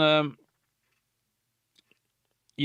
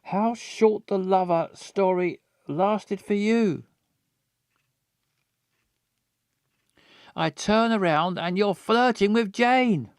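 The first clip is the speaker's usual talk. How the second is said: angry; 6 semitones higher than usual. Acted. Voicing angry character lines.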